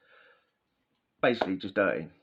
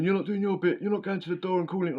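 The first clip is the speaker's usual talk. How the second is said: high, whiny nagging cellmate voice; 9 semitones higher than usual. Retold or imitated. Cellmate waking him at half six, high and nagging. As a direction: mimic him whining at dawn.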